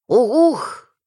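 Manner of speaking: high, loud drawn-out hoot; bird cry. Acted. Narrator voices the repeated bird signal, hooting.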